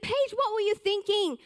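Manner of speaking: put-on mother scolding her child over the burnt pillow; angry mum. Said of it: "And she got so angry".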